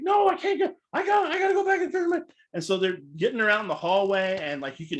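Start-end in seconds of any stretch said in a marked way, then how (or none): 0.0-2.2 high, loud, pleading protest; drunk protest. Retold or imitated. Drunk speaker being led off stage, high pleading protest. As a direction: beg to go back on stage. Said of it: "She's like".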